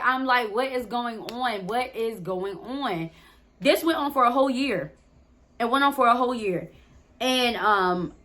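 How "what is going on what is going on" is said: slow, soft, stunned repeating; dazed hurt. Retold or imitated. Heartbroken girl asking herself why he left, soft and slow. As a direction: murmur it to yourself, lost and hurt. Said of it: "I'm upset".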